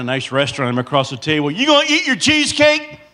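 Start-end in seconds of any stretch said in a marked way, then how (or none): 1.5-3.0 loud question. Retold or imitated. Loud man in restaurant.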